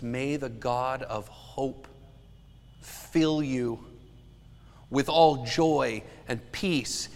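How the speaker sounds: slow, solemn reading of the blessing; scripture blessing. Acted. The preacher reads Romans as a blessing over the church, solemn and slow. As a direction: let each phrase of the benediction land slowly.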